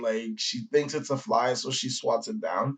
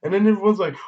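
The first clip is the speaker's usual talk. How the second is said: normal talking voice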